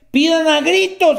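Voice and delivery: loud, high cry of asking. Shown as a demonstration. Preacher quoting the verse, loud and high. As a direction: call it out like a hungry baby. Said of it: "que dice".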